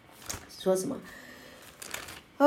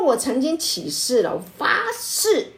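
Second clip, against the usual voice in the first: eager vow; 6 semitones higher, 4 dB louder than usual. Acted. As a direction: voice jumps way up, swearing proudly. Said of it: "你看，他说".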